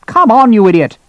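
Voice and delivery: loud, high exasperated shout; angry impatience. Acted. Colonel to hesitating doctor, angry and impatient. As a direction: yell at a dithering underling.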